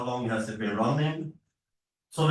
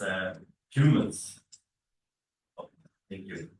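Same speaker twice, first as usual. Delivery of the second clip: ordinary talk, nothing marked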